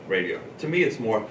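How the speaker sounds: usual speaking voice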